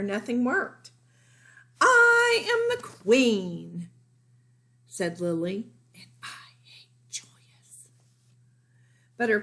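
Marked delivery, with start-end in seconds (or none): 1.8-3.9 high, loud and slow, regal; grand proclamation. A narrator's reading. Lily announcing to her parents she is queen, regal and drawn out. 5.9-7.9 hushed confession; whispered aside. A narrator's reading. Lily confiding her hatred of Julius, in a whisper. Lily's secret hateful aside.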